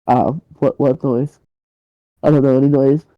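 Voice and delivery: halting, guarded denial of any noise; awkward feigned ignorance. Acted. Kidnapper caught at the door by his neighbour, awkward feigned ignorance. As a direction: play dumb, awkwardly and without energy. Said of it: "sort of shuffles awkwardly".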